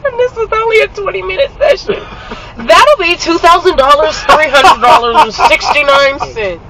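high, loud, laughing mock sales pitch; playful, high-pitched loud kidding; joking bill. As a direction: call out a pretend price through laughter. Joking bill after tearful laughter.

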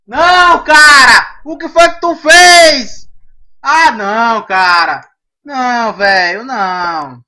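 loud, high-pitched dismayed yelling, easing off toward the end; shouted protest. Real, not acted. Gamer yelling at whoever killed in the game, loud dismay. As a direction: cry out in protest, dragging out the words.

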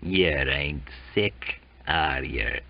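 low, slow Cockney sneer; low sly sailor voice. Acted. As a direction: lean in and needle him low.

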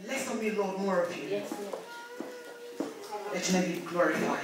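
soft, low, humble praying; prayer. Real, not acted. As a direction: pray quietly and low, with pauses. Leads opening prayer before sermon.

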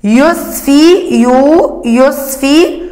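يصفي slowed with يو pulled out; teacher isolating يو in يصفي; sounding out. Shown as a demonstration. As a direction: break يصفي to show يو. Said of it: "ننطق".

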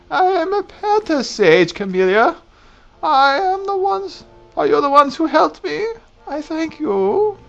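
put-on high, creaky old woman voice; old lady voice. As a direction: speak like a frail old crone. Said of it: "I get to do an old lady voice".

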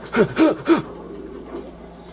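high, breath-only gasps in a row; shaken gasps. Real, not acted. Player just saved by the crowbar, shaken repeated gasps. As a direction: gasp repeatedly after the scare. Catching breath after fight.

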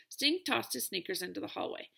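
ordinary delivery, nothing marked

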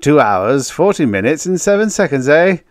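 loud, high mock precision; teasing chuckle. A narrator's reading. Joker mocking outpost man's exact count, teasing. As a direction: rattle it off high and loud, ribbing him. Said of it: "Town chuckled".

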